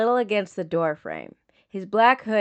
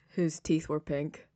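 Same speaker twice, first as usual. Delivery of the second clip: dully; 3 semitones lower, 7 dB quieter than usual. Acted. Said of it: "Sokka says dully".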